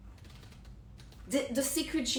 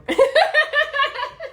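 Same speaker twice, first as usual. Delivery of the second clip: bright laughing burst, well above her voice; high burst of laughter; 7 dB louder than usual. Real, not acted. Vlogger laughing at her own vow to viewers, loud laugh.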